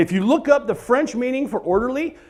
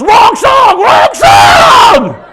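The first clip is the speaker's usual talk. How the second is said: panicked high shout; 12 semitones higher, 11 dB louder, much slower than usual. Retold or imitated. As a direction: yell at him, much louder and higher. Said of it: "the guy is next to me goes".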